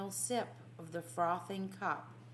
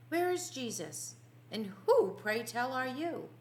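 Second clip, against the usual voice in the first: high, loud startled questioning; a woken disciple challenging a stranger in alarm; startled demand; 6 semitones higher, 5 dB louder than usual. Acted. Peter, just woken, demands who the stranger is: startled, high and loud.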